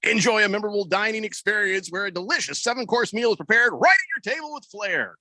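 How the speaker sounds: upbeat radio commercial read. Acted. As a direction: sell it like a radio spot. Reading a radio ad.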